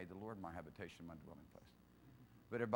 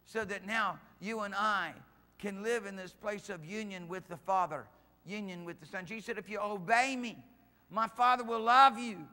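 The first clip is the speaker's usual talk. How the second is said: full-force preaching; 10 semitones higher, 12 dB louder than usual. Real, not acted. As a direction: proclaim it to the congregation, raised and slowed.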